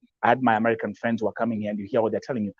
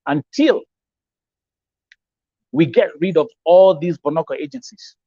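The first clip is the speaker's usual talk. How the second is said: louder and higher, pounding out the demand; raised voice against the agencies; heated declaration; 5 semitones higher, 7 dB louder than usual. Real, not acted. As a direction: declare it like a rally cry. Heated vow against rogue agencies.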